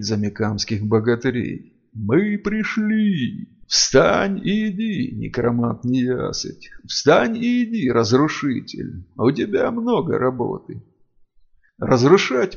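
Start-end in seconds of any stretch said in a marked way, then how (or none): 1.9-10.8 high, slow otherworldly chant; booming spirit voice. Acted. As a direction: call out like ancient spirits. Spirits command the necromancer.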